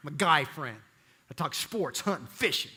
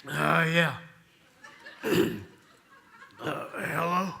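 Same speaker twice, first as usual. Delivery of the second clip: groggy, throat-clearing phone answer; just woken, clearing the throat to talk; much slower than usual. Acted. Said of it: "It'll sound like this".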